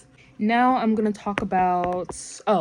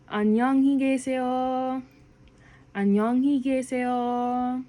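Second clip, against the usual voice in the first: slow; 6 semitones higher, faster than usual. Shown as a demonstration. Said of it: "That's, like, slow".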